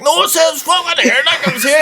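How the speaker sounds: high-pitched mock shout of insistence; retold heated shouting. Retelling two mates arguing over a place, high mock shouting. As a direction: act out a mate insisting, voice pitched right up. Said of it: "They were proper shooting at each other".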